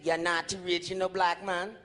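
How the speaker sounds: a hint of an African cabbie's voice, low; cab driver. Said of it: "to turn around and say".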